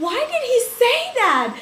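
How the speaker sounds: high, loud, fast mock surprise; flustered surprise. Girl reacting to the boy's love letter, flustered. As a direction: squeal it out in flustered disbelief.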